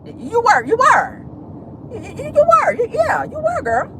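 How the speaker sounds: squealing high, playful accusation; high mock insistence. Vlogger teasing Sheree about her walk, squealing high. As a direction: tease her like a sassy friend.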